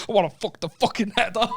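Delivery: mock aggressive. Teasing a friend by quoting him, mock aggressive. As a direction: imitate a friend's aggressive outburst. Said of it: "You said that so aggressively".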